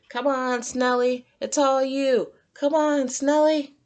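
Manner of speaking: high, bright cheering voice. A narrator's reading. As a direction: urge the snail on. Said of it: "Squidward cheered".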